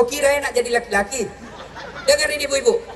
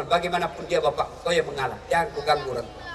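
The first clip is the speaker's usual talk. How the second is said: much lower and softer commanding; solemn divine voice; 8 semitones lower, 8 dB quieter than usual. Retold or imitated. God telling Ibrahim to yield to his father, solemn and low. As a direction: speak it as a grave low decree. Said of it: "Allah berfirman".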